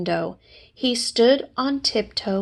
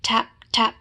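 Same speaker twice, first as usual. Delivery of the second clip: usual speaking voice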